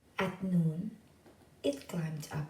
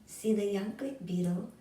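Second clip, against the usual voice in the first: plain reading voice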